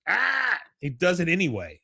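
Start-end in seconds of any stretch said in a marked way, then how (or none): 0.1-0.6 sudden high yelp of pain; mock yelp of pain. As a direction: cry out as the thumb bends back. Dale hurting thumb.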